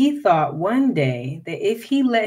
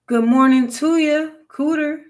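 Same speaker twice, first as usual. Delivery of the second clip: higher, raised-voice greeting; louder call; 8 semitones higher, 5 dB louder than usual. A narrator's reading. Jim tries the silent cooter, calling high and louder. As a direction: call out louder to someone hiding. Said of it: "a little bit louder this time".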